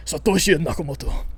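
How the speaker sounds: "Satoshi Nakamoto" in a hammed-up French accent; playful French accent on "Nakamoto"; jokey French accent, stressing the last syllable; mock French accent. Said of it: "I've said in a French accent".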